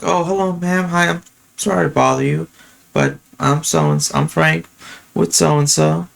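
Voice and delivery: high, polite doorstep voice; tone matched to old lady. Retold or imitated. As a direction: pitch it up politely for an elderly customer. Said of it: "I change up my tone, too, to match them".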